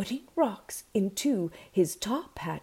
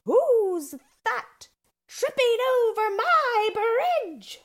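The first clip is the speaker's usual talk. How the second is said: high, loud, stretched-out troll cry; roaring troll; 4 dB louder, slower than usual. A narrator's reading. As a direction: roar the challenge in a high troll voice. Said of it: "roared her familiar line".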